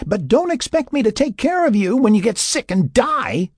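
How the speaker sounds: very high, strained yelling; high-pitched heated parting shot; angry yell. A narrator's reading. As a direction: yell after him in exasperation. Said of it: "Sarah yelled after him".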